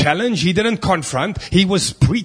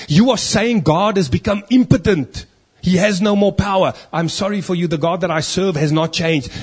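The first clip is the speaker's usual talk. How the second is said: raised, forceful preacher's voice building to a peak; impassioned preaching; 3 semitones higher, 3 dB louder than usual. Real, not acted. Preacher rebuking doubters of God's power, impassioned and forceful. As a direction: drive the point home to the congregation.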